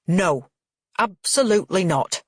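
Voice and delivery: emphatic angry refusal; exploding. A narrator's reading. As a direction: burst out firmly, with measured force. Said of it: "Frank explodes".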